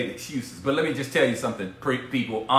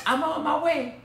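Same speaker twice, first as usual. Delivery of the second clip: high, light imitation of the wife; wife's reply; 11 semitones higher, 3 dB quieter than usual. Quoting his wife saying she is coming, in a light high voice. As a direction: lightly voice her answer, much higher. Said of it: "She said".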